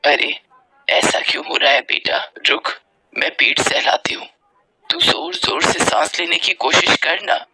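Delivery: low, breathy motherly voice; hushed, anxious comforting; worried mother. Acted. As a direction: soothe a child who cannot breathe.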